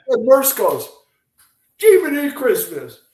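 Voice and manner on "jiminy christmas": raised Oklahoma twang on the exclamation; light Oklahoma accent imitation. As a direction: imitate an Oklahoma friend's outburst. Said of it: "in his Oklahoma accent".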